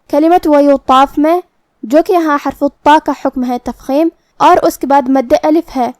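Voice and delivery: normal talking voice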